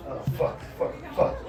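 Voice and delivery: unvoiced whisper under the breath; whispered cursing. Real, not acted. Host whispers to himself at the mic. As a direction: curse quietly to yourself. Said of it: "mutters to self".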